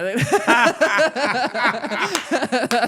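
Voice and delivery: loud, high laughing fit; real laugh, loud and high; burst of laughter. Real, not acted. Laughs at her own joke.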